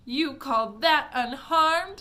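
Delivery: slow, loud indignant retort; snapping. A narrator's reading. Mother snapping angrily at Wonka. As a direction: snap back in disbelief. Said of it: "snapped Missus T V".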